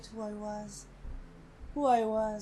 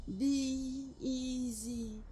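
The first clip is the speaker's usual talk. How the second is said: slow echo; 6 dB quieter, much slower than usual. Acted. Voice repeating in her memory.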